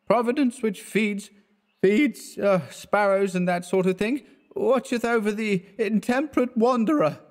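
put-on maudlin drunk. A narrator's reading. Drunk Clifford grows maudlin to his friend, high tipsy. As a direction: get weepy and tipsy. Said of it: "becoming maudlin".

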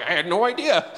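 high, loud mock astonishment; astonished owner. Owner reacting to the appraiser's valuation, acted astonishment. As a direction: play the stunned roadshow owner.

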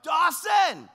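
put-on full-voice, high, drawn-out call for a lost child; loud, high-pitched desperate shout; yelling. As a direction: yell the name across a crowded park. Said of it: "I just started yelling".

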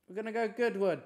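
high, loud and excited; excited announcement. Real, not acted. Streamer telling a mate about going to Goodwood, excitedly. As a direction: call it out to a mate. Excited plan, then whispered repeat.

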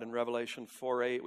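ordinary talk, nothing marked

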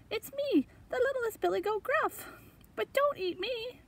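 very high, tiny goat voice; little kid goat in high pitch; littlest goat. Acted. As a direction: squeak it out as a small pleading goat. Littlest goat pleads with troll.

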